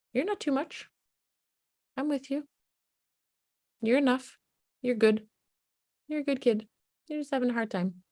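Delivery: high, soft reassuring voice; soothing a child who feels unlovable; tender reassurance. Shown as a demonstration. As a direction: softly tell the child they are enough.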